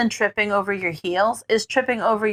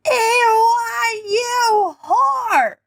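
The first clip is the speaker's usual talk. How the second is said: put-on high, drawn-out drunken yell; drunken shout; 14 semitones higher, much slower than usual. A narrator's reading.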